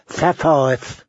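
mock the lisping captor on "Sapphires"; "Sapphires" lisped as "thapphireth"; imitated lisp. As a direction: say "Sapphires" with th for each s. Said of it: "make the ghost say".

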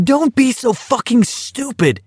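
high, furious yell. A narrator's reading. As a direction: yell at him in anger. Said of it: "Garrity yelled at him furiously".

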